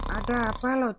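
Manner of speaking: usual speaking voice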